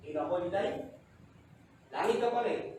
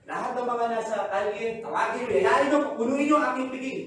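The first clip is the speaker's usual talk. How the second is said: the father's command; 4 semitones higher, 6 dB louder than usual. Acted. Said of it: "Sabi nung tatay".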